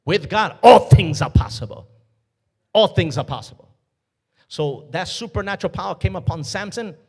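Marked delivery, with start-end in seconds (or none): 0.1-3.7 loud preacher's proclamation; preaching. Acted. Preacher booming to the church, full force. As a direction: proclaim it to the congregation.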